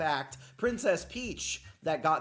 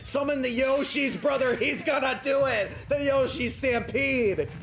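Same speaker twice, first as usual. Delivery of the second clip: raised, urgent sports-commentator voice; excited play-by-play; 6 semitones higher, 5 dB louder than usual. Real, not acted. Commentator urging on a passive Yoshi, excited play-by-play. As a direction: call the fight live, building to louder exclamations.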